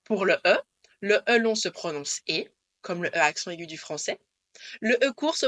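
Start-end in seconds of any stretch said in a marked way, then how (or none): none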